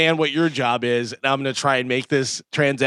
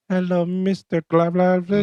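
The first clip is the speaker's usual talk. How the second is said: high droning mock salesman; telemarketer caller; 5 semitones higher than usual. Solicitor greets the man he called, mock high-pitched spiel. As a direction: imitate a cold caller reciting a name, pitched up.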